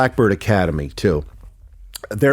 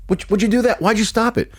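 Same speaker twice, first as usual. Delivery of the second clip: higher-pitched retold challenge; imitated annoyed reproach; 10 semitones higher than usual. Mentor questioning him for stopping the mix, imitated annoyance. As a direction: demand an explanation, imitating the mentor.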